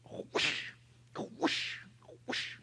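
breathy imitation of a swishing whip. Imitates whip swishing through air.

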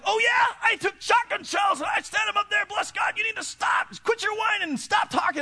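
high, loud hammed-up scolding; mock stern shout. Teacher yelling at whining kids holding Bibles, mock shout. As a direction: bark it like a drill sergeant in Sunday school.

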